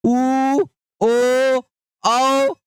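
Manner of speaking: ऊ, ओ, औ said with fully rounded lips; rounded vowel demo. Shown as a demonstration. Teacher repeats the rounded vowels for children. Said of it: "पूरा होठ ... गोल हो जा रहा है".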